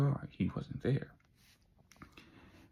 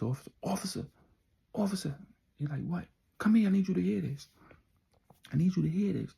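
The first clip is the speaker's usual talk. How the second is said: higher, louder calling out for the officer; urgent calling; 7 semitones higher, 5 dB louder than usual. Retold or imitated. Retold calling for help. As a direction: Gino calls the officer over from his hospital bed, in urgent mock shouts.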